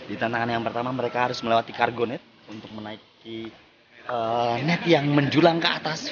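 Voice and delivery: steady presenter read to the camera; walk viewers through the course like a host; event host narration. Acted. Host introducing the obstacle course to viewers, presenter narration.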